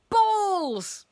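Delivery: high, loud drawn-out cry; frantic teacher's yell; exasperated outburst. A narrator's reading. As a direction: blurt it out in outrage. Teacher's outburst at missing balls.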